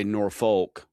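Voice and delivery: "Norfolk" with full R and "folk" ending; "Norfolk" rhyming its end with "oak"; Nebraska pronunciation. Shown as a demonstration. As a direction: say "Norfolk" as "nor-FOKE". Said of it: "Nebraska say it is Norfolk".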